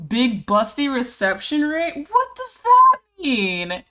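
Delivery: squeaky incredulous what does that mean; baffled. Real, not acted. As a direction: ask it in total disbelief. Rereading stranger raid name.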